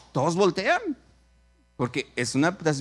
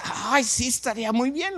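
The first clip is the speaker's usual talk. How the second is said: high, gushing acted yes; delighted agreement; 7 semitones higher than usual. Girl agreeing to start a church, delighted. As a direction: jump at the offer.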